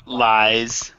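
LIES drawn out with a buzzing Z; clearly enunciated. Real, not acted. Repeating for a co-host who heard lice, drawn out and clearly enunciated LIES. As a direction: say "Lies" long and clear, ending in a Z.

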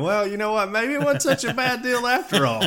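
high, light mock British take. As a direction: imitate smug English onlookers. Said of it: "they gotta be sitting back going".

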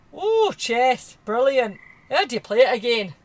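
a loud, hammed Scottish accent. Jeffers the gamekeeper mocking the chess game in Scottish. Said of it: "A random Scottish accent at the end".

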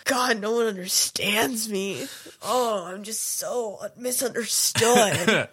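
put-on slow, whiny misunderstood teen; emo teen.

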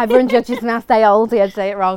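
loud and excited. Real, not acted. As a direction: raise the voice, laughing it off. Excited about Aldi pronunciation.

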